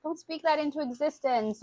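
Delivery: mock alarmed mom. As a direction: stop them before the words land. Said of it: "I'm like".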